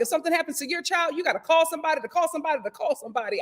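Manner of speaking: fast rattled-off mock retort. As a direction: rattle it off quickly, teasing. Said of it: "She was just like".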